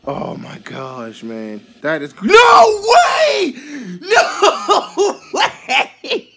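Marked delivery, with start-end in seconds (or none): 2.2-6.3 loud, high shout of amazement; screaming with delighted disbelief; ecstatic disbelief. Real, not acted. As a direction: shout it in real shock, laughing. Second rare card pulled.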